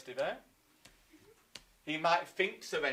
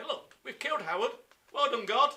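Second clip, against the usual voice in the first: raised, hammed-up boast to heaven; mock proud announcement; 6 semitones higher, 7 dB louder than usual. Teacher plays Normans building a church on the spot, mock proud boasting. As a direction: call it up loudly, congratulating God. Acting out Normans showing off to God.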